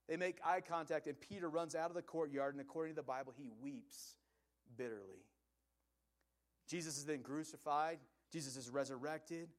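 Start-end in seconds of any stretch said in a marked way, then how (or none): none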